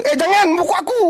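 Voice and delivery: gruff neighbour refusal. Acted. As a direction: bark it like a possessive neighbour. Neighbour refuses, acted quarrel.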